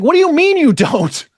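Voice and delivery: high-pitched indignant comeback; incredulous. Retold or imitated. Speaker answers people who say you don't, incredulous. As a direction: throw it back in disbelief.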